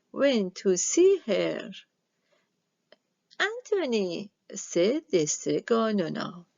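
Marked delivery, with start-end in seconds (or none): 3.4-4.3 a lightly higher grandma witch calling his name; old witch voice. Acted. Strega Nona calling her new helper in a lightly higher old-woman voice. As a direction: call him like a kindly old witch.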